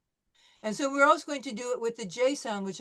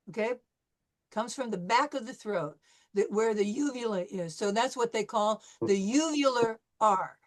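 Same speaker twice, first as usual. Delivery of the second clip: ordinary talk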